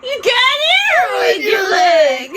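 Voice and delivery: high, loud, laughing cry. Real, not acted. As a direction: yelp it out through laughter. Laughing reaction to movie.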